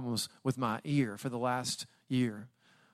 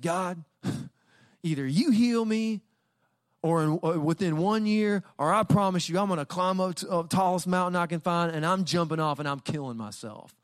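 ultimatum to God; 5 semitones higher than usual. Retold or imitated. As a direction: shout the threat up at God, voice pitched high. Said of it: "I get into a shouting match with God".

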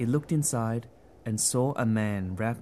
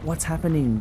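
high, louder startled cry; alarmed as the earth trembles; frightened cry; 6 semitones higher, 3 dB louder than usual. Acted. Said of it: "the gum tree cried".

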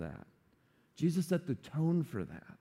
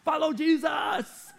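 rising to a high laughing shout; hype pitchman; 10 semitones higher than usual. Pretend. Imagined cheesy Jesus selling discipleship, hyped-up pitch. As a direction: sell it like a cheesy recruiter. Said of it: "Jesus didn't say".

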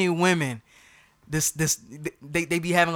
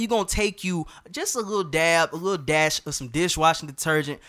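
ordinary delivery

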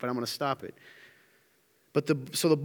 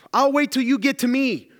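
higher, louder stalling call; 9 semitones higher, 5 dB louder than usual. Acted. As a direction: call across the water to him. Peter stalling, calling to Jesus.

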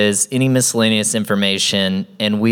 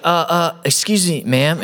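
higher, hesitant mock-nervous approach; awkward, nervous; 8 semitones higher than usual. Nervous guy approaching a stranger in a parking lot, awkward. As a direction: imitate a nervous first-timer, stumbling and high. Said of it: "he is so awkward, so nervous".

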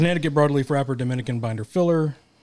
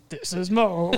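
put-on high, slow giggly anime girl; 6 semitones higher, slower than usual. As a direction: giggle through a squeaky anime intro. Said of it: "your anime voice again".

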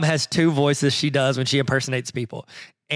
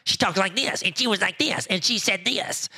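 higher-pitched imitation of mom's ally; mom's agreeing voice; 4 semitones higher, 3 dB quieter than usual. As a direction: imitate mom voicing someone on her side. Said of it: "if you're on her side".

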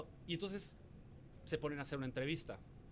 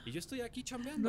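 raised, high-pitched indignation; indignant protest; 8 semitones higher than usual. Retold or imitated. Contestant tells intruders he is working, indignant protest. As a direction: protest that you're busy working. Retelling his protest to the crew.

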